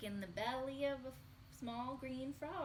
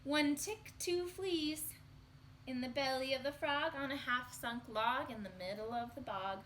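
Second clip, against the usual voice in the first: lively, louder singsong refrain; reading to children; 2 semitones higher, 3 dB louder than usual. Acted. Teacher reading the refrain aloud to children, singsong. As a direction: count them off brightly for the kids.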